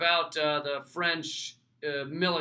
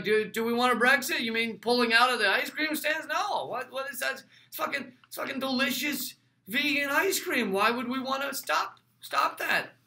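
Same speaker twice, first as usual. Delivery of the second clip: higher-pitched, incredulous pretend objection; mock bafflement; 7 semitones higher than usual. A voter balking at losing free ice cream, mock bafflement. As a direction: act baffled that anyone would want out.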